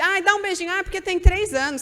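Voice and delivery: mock-cooing parent; doting parent. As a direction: egg the toddler on sweetly. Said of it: "Aí fica".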